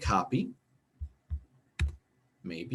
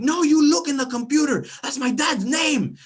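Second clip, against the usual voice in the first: put-on very high child's shout, louder; shouting little boy; 7 dB louder than usual. Boy yelling at his teacher to check, high child shout. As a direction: yell like an indignant kid. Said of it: "And he's like".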